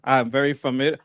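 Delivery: usual speaking voice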